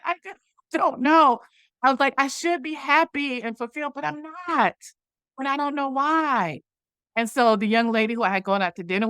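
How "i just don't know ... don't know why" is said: high imitated tearful whine; mock crying. Telling the coach she should be happy, mock crying. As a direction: whine it out as if weeping, playfully. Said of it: "the ugly cry".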